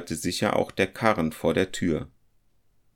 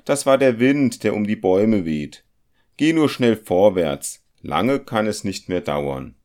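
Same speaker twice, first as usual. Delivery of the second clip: much louder and higher, hurrying his friend along; brusque compass-smith; 4 semitones higher, 7 dB louder than usual. Acted. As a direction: push him onward, loud and dismissive. The compass-smith voiced in the story. (ungeduldig drängender Zirkelschmied, deutlich lauter und höher)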